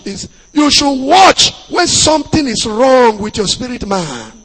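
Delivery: ordinary talk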